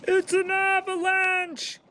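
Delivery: high, urgent cry of alarm. A narrator's reading. Toad cries out to Frog as rocks fall, alarmed. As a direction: warn of the falling rocks. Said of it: "cried Toad".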